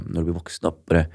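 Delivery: soft, hushed words of a dying man, lightly voiced; a hint of a frail uncle speaking quietly. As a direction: murmur it gently like a weak uncle in bed. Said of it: "Han bare".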